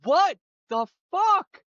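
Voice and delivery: high, slow shocked what the fuck; mock shocked squeal. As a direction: gasp it out in shock.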